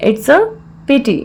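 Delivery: measured, with a clear mid-phrase pause; broken into parts. Shown as a demonstration. Teacher repeats the phrase for learners, in separated parts.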